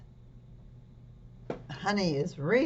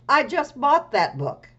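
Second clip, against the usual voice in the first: cheerful Amelia; 8 semitones higher, 8 dB louder than usual. Acted. Amelia voiced in story.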